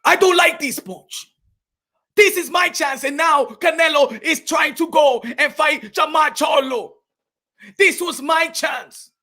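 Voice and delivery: mock protest in a thick accent; Makabu. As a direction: plead your case, indignant and accented.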